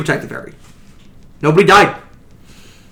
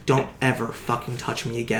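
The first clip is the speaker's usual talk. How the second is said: icy nurse; 5 semitones lower, 12 dB quieter than usual. Acted. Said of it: "nudges the nurse who just goes".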